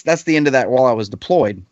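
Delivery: ordinary talk, nothing marked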